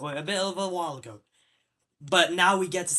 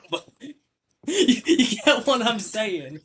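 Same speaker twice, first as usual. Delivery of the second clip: laughing; 5 semitones higher, 7 dB louder, much slower than usual. Real, not acted. Laughing while making his point.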